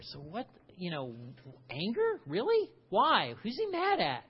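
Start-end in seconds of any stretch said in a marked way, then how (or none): 1.7-4.2 high, rising disbelief at each question; incredulous questions. Acted. Preacher to congregation, asking in mock disbelief. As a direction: act out baffled surprise, pitch jumping up.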